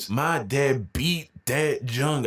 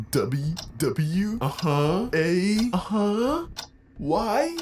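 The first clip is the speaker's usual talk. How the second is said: put-on scared kid stammering; 7 semitones higher, much slower than usual. Crying kid trying to spell.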